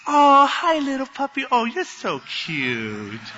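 gasp, then high cooing, sliding down slowly; hammed-up baby talk to a pet; gushing over a puppy. Quarrelling couple greeting their dog, mock adoring coo. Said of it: "just exalting this dumb little puppy".

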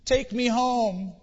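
put-on a lost little boy begging through tears; crying lost child. Lost boy on the stairs crying to the officer, high tearful plea. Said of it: "who was crying".

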